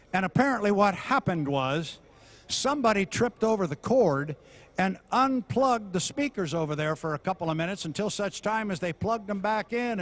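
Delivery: ordinary talk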